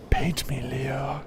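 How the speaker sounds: hammed-up high plea, trailing into a whisper; mock breathy plea. Joking to co-player about being painted by DiCaprio, mock breathy plea. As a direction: play at begging Leo, high then whispered.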